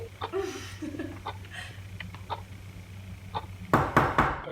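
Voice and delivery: high breathless laugh through tears; tearful breathy laugh. Real, not acted. Wife laughing sadly about her marriage, tearful laugh.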